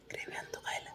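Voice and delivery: soft unvoiced whisper. Real, not acted. Owner whispering a treat promise to his pet, whisper. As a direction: breathe it like a secret.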